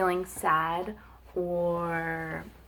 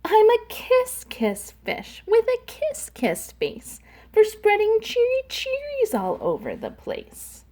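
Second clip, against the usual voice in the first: much higher, cheery singsong; happy fish refrain; 14 semitones higher than usual. Acted. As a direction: sing the new refrain with delight.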